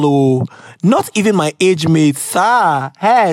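normal talking voice

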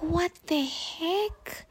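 high, slow, baffled retelling; drawn out in disbelief at the bus seat; baffled what the heck. Retold or imitated. Retelling her confused reaction, drawn-out high disbelief.

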